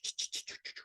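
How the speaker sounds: usual speaking voice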